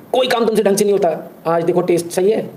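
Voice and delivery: scolding madam. Acted. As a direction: nag the maid about her cooking.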